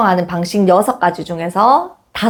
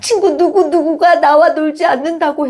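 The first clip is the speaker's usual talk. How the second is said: put-on higher, childlike tattling voice; whining child; 6 semitones higher than usual. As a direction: imitate a hurt kid telling mom. 아이가 엄마에게 하소연. (엄마에게 이르는 아이 흉내, 높은 목소리)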